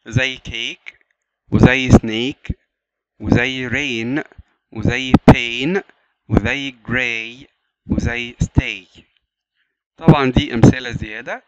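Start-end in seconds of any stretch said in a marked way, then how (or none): 0.1-9.0 slow examples. Shown as a demonstration. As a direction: read each example slowly. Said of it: "فيها ال long vowels". (ببطء وبهدوء)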